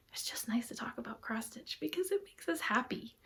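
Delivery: soft happy whisper. Real, not acted. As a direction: breathe it out softly. Fond aside about cross stitch.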